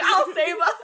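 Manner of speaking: high, loud heroic vow; heroic declaration. Pretend. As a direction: proclaim it like an action hero. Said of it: "I'm like".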